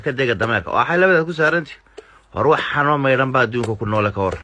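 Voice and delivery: ordinary delivery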